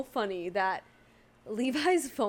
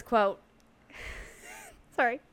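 usual speaking voice

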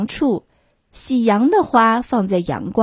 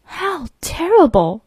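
mock dismay; 7 semitones higher than usual. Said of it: "groaned his mother".

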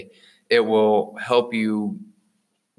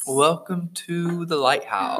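higher, slow, soothing welcome with a laugh; soft podcast host voice; 7 semitones higher, slower than usual. Shown as a demonstration. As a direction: ease them in like a meditation guide. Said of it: "sounds like you're about to, like, enter a yoga session".

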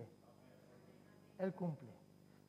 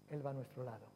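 usual speaking voice

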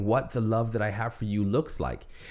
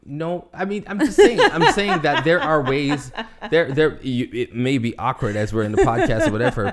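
high, loud, laughing through words; embarrassed laugh while explaining; 3 semitones higher, 8 dB louder than usual. Real, not acted.

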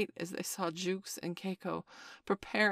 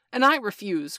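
loud, higher defiant cry; 4 semitones higher, 12 dB louder than usual. Acted. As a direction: shout it out bravely. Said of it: "cried Michael".

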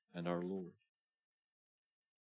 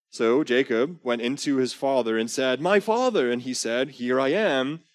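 louder, higher storytelling read of scripture; dramatic scripture reading; 7 semitones higher, 12 dB louder than usual. Acted. Preacher reading Genesis aloud to the congregation, loud and dramatic. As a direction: read the Bible scene out big for the room.

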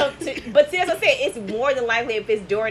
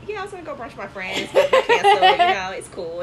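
put-on dainty proper voice, rising much higher; proper little voice; 8 semitones higher, 3 dB louder than usual. Retelling a girl's brunch excuse to a date, proper little voice. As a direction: talk sweet and proper, a laugh creeping in. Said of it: "But she talking like this".

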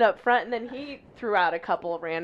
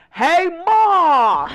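high, loud hillbilly holler; long hammed-up hillbilly yell; [name]; 8 semitones higher, 9 dB louder than usual. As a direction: bellow for Ma in a hillbilly accent. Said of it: "his hillbilly accent that he does".

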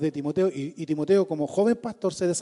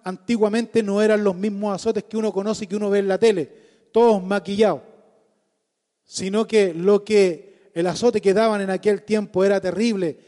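impassioned loud sermon delivery; preaching at full force; 3 semitones higher, 5 dB louder than usual. Acted. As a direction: preach it hard and fervent. (predicación apasionada a toda voz)

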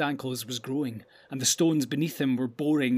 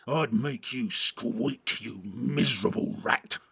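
second orc voice; 2 semitones lower, slower than usual. A narrator's reading. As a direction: threaten him slowly, like an orc. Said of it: "said the other".

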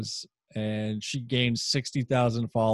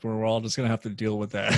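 ordinary delivery

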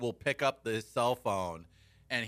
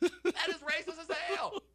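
laughing mock outrage. Reacting to a listener's text about racism, laughing mock outrage. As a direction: play shocked while cracking up.

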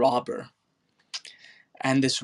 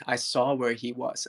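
ordinary talk, nothing marked

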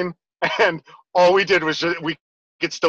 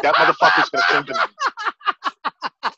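high, slow, laughing mock outrage; slow, high-pitched mock indignation through a laugh; mock outraged sister; 14 semitones higher than usual. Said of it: "'cause she's like".

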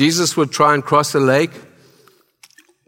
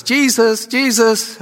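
high calling voice of a mother; 9 semitones higher, slower than usual. Retold or imitated. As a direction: call out to him like a mom. Mary calling her son.

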